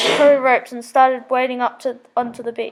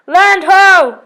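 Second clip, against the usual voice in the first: loud excited shout from the mast; yelled; 6 semitones higher, 9 dB louder than usual. A narrator's reading. Martin in the crow's nest shouting land to crew, loud cry. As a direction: call it out high and loud, spotting land. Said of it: "yelled Martin".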